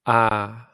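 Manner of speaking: long open ah held out; long ah vowel held. Shown as a demonstration. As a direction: stretch the ah. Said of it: "You just say the ah longer".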